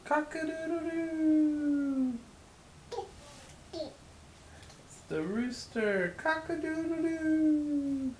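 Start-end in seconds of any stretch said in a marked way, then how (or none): 0.0-2.1 put-on high, sing-song rooster crow for a toddler; playful high cock-a-doodle-doo. Parent imitating rooster for toddler. 6.2-8.1 put-on high, sing-song rooster crow for a toddler; playful high cock-a-doodle-doo. Parent imitating rooster for toddler.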